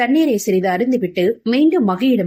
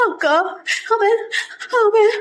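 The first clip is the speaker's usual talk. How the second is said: high, broken sobbing words; crying; 12 semitones higher than usual. A narrator's reading. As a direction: gasp it out word by word, weeping. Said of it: "அழுதாள் தூரிகை".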